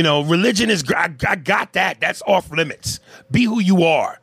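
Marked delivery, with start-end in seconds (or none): none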